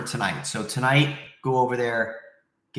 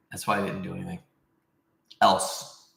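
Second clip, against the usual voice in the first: ordinary talk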